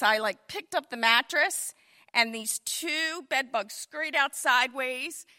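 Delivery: ordinary talk, nothing marked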